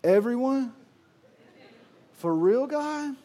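high, doubting echo; incredulous. Acted. Preacher voicing the congregation's disbelief, incredulous. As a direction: act out disbelief at the word. Preacher mock-questioning God.

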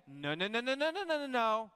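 lightly voiced finger-wagging grandma; a hint of a scolding grandma; mock scolding grandma. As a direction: wag your finger and tut like a grandma. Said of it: "Your grandma ever wagged her finger at you".

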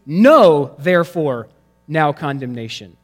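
"Know" punched loud at the front, then a pause; stress on Know. Shown as a demonstration. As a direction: hit "Know" hard and let it hang before "therefore". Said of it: "the original Greek text literally reads".

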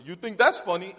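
retelling the friend's dad snapping; mock angry dad. Father to laughing son, mock angry challenge. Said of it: "turns around and says".

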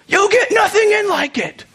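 judge's rebuke. Retold or imitated. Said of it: "Judge Smales goes".

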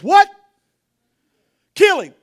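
sudden loud outrage, kill him; outraged. Acted. David furious at the rich man's crime. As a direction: burst out in furious disbelief.

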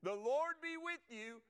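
the greeting, proclaimed again. Shown as a demonstration. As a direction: call The Lord be with you across the room. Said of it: "Okay, y'all ready?".

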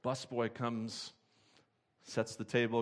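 ordinary talk

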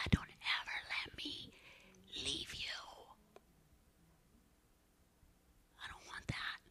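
hushed whispered plea to God; whispered prayer. Retold or imitated. Retelling her prayer to God, whispered and pleading. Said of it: "and I cried, I said".

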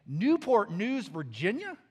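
loud, rising disbelief on the place name; astonished reading. Retold or imitated. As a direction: read it out as a baffled question. Reads ID aloud, astonished.

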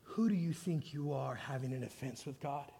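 quiet voice of God speaking to him; voice of the Holy Spirit. Retold or imitated. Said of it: "spoke to me clear as day".

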